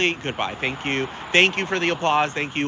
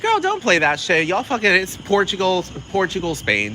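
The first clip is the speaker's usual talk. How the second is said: sassy call-out; 6 semitones higher than usual. Retold or imitated. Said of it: "And I'll be like".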